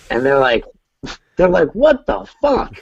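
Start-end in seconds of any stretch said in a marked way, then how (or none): 1.7-2.8 shocked coworkers. Retold or imitated. Coworkers react to lemon rubbing.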